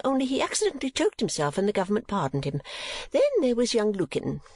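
high, rambling, chattering mother; Mrs. Nickleby. Acted. Mother listing her old suitors, high and garrulous. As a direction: prattle on in a high, self-satisfied voice.

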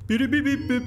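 high, quick beeping noises; imitated phone beeps. Streamer pretending messages arrive on the phone, mimicked beeps.

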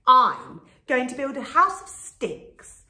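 proud and loud little pig. A narrator's reading. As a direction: boast it like a proud piglet. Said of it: "he said proudly".

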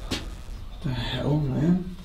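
slow awed murmur; hushed whisper. Real, not acted. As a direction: breathe it out in awe. Awed in dark cellar.

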